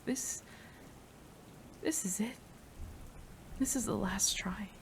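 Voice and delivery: whispered, urgent pleading; anxious whisper. Acted. Scientist waiting over her lover's body, anxious whisper. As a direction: whisper it like a prayer you barely dare say. Desperate wait for the serum.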